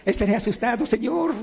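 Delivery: high, hammed-up frightened plea; a mock whimper to the Lord, pitched high; mock fearful. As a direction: pretend to be scared of the dark valley. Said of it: "¿Así dice?".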